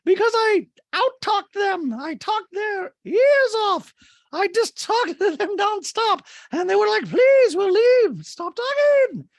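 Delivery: very high, loud, stretched-out mock drama; hammed-up shouted impersonation; Dorit. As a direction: caricature her, slow and over-emphatic. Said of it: "And Dorit's like".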